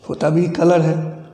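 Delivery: ordinary delivery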